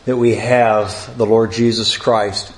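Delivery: low, measured prayer voice; opening prayer. Real, not acted. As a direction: lead the congregation reverently in prayer, pitched low.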